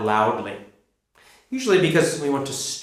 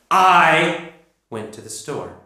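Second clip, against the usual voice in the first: slow, with a loud, drawn-out "I"; stress on I; 2 semitones higher, slower than usual. Shown as a demonstration. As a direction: make "I" the loudest word, then drop back. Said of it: "If I say that I the most loudly".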